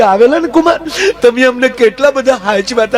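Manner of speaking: sweet high mom on the phone; mother-in-law voice. Acted. Mother-in-law asks son-in-law what he wanted, high sweet voice.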